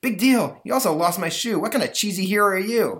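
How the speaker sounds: put-on high, loud bratty boy; snotty kid. Ungrateful boy scolding his rescuer, high and bratty. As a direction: sneer at the hero.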